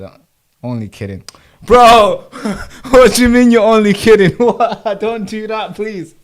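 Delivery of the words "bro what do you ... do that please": loud, high, laughing protest at chat; outraged shout. Real, not acted. Streamer shouting at a viewer who joked about no sound. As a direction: yell it in mock outrage, then plead.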